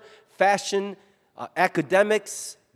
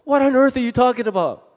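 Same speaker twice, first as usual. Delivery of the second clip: baffled question; 6 semitones higher, 4 dB louder than usual. Acted. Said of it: "They want me to go".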